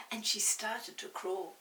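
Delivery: ordinary delivery, nothing marked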